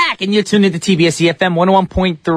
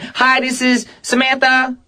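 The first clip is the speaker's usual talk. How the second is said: higher, slower pretend self-introduction; mock introduction; 7 semitones higher, slower than usual. Host imagining co-host's new name, mock introduction. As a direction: draw out the made-up name, pitched up. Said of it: "tomorrow you be like".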